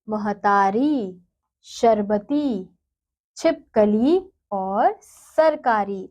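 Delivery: each word said slowly and clearly, with pauses; reading words aloud. Shown as a demonstration. Teacher reading a word list to children, slow and clear. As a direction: model every word for the class to repeat. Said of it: "शब्दों का उच्चारण कीजिए".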